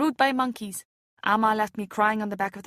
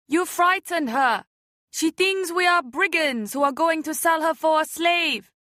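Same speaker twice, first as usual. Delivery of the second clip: scolding; 5 semitones higher, 4 dB louder, slower than usual. A narrator's reading. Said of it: "scolded the woman in the boat".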